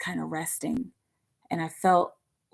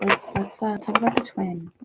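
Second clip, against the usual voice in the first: ordinary talk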